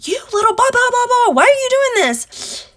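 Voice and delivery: high, loud mock tirade at the cat; mock scolding. Scolding the cat over the litter box, high mock tirade. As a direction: scold the cat in a shrill, mocking rant. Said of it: "I could have been like".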